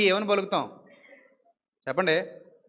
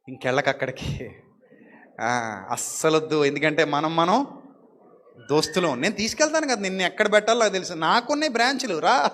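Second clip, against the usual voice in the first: sly tempter; 5 semitones lower, 4 dB quieter than usual. Retold or imitated. The devil luring the offended believer to his branch, sly and soft. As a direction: lure him in quietly like a false friend.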